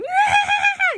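very high mock shriek; high wailing scream, imitating a panicking toddler; imitated toddler scream. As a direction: imitate a child's weird shrill screaming. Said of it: "like, screaming, like, the weirdest way".

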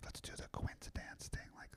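secretive whisper, very soft; hushed, conspiratorial whisper. Retold or imitated. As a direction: whisper an idea into a friend's ear. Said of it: "I was, like, whispering in his ear".

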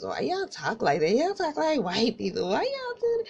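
imitated taunting, pitch climbing sharply at the end; ripping into them. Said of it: "like, ripping into us".

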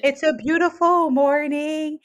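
high singsong cheeriness; mock blissful greeting. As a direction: imitate someone delighted by the morning, stretched out. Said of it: "being all like".

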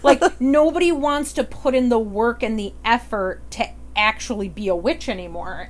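ordinary talk, nothing marked

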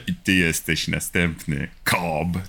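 low, slow, quiet threat to a victim; a sinister promise, dropped low; menacing villain. Acted. Villain line to his next victim.

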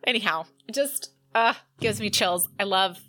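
ordinary talk, nothing marked

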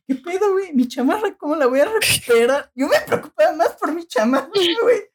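high-pitched, squealing fretting; mock panic. Student worrying about a jacket after the quake, mock panic.

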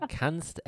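"kannst" lifted high at the end; stress on kannst. Shown as a demonstration. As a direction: raise "kannst" like a caveat left open. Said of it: "Kannst ist die Betonung".

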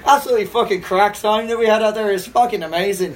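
enthusiastic Irish brogue; playful imitation of an Irish runner; Irish accent. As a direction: rave about it as an Irishman would. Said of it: "It's a beautiful accent".

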